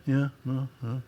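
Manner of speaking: a husband grunting at his wife. Retold or imitated. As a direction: grunt it without interest.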